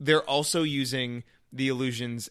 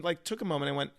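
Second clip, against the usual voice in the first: normal talking voice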